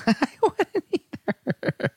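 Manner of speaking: words stretched out by real laughter; laughing. Real, not acted. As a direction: crack up while agreeing. Cracking up at co-host's remark.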